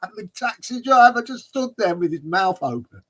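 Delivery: high, helpless laughing speech. Real, not acted. Storyteller reaching his own punchline, really laughing. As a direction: talk through real laughter.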